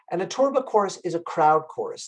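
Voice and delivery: usual speaking voice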